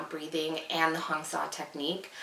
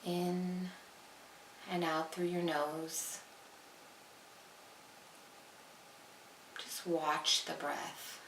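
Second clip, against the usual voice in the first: guided meditation; 13 dB quieter than usual. Acted. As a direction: draw the words out quietly, with long pauses.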